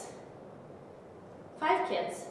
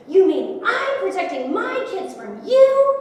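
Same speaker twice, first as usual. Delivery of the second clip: loud, high, drawn-out acted outrage; imitating an enraged, incredulous husband; angry shouted retort; 8 semitones higher, 11 dB louder, slower than usual. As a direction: throw the words back in a slow yell. He shouts her words back.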